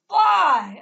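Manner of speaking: high, very loud shout of one word; imitated yell. Woman going by yells at her, imitated yell. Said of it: "she yells as she's going by".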